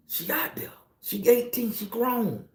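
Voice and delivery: breaking, whispered words about his grown daughter; barely getting it out; choked up. Real, not acted. Father choking up thinking of his grown daughter. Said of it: "I think about all of this and I cry".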